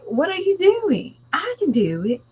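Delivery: high, surprised question; shocked daughter. Retold or imitated. Daughter surprised her mother is up early, high mock. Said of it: "She was like".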